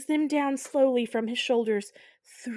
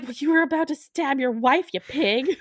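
higher and louder, a hammed-up huff; mock indignant; 5 semitones higher, 5 dB louder than usual. Sharon scolding Martin, mock indignant huff. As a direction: scold him like a pig. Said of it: "She's like".

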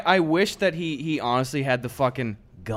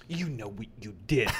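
lower-key, soft confrontation; mock accusation; 3 semitones lower, 9 dB quieter than usual. Host pretending to confront Leno, hushed mock accusation. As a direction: say it softly, on the edge of laughing. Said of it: "get Leno on here and just go".

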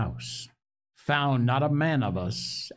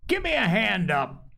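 a hint of angry Silver calling out, higher; lightly voiced pirate cry; 8 semitones higher than usual. A narrator's reading. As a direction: Silver demanding help, a touch rough. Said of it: "he cried".

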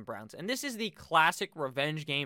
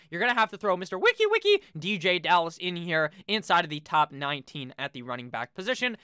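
ordinary delivery

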